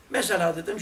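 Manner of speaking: ordinary talk, nothing marked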